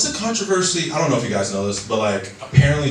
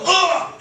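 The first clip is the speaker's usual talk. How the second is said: mock climax moan. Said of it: "you just hear him go".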